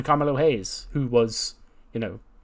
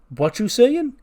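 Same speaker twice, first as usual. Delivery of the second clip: sharply higher, taken aback; a higher startled "what?"; offended friend, pitched up; offended surprise; 11 semitones higher, 5 dB louder, slower than usual. Acted. Melo takes offence at Trick.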